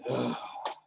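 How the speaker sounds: soft audible sigh out; exhale demo. Shown as a demonstration. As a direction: let the breath go with a quiet sigh. (mahinang buntong-hininga)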